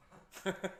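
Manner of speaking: quick high laugh; a sudden bright laugh; short burst of laughter. Real, not acted. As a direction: laugh out at the punchline. Laughing at his own story.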